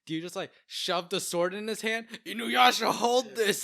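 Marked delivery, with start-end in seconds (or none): 2.2-3.6 mock shout.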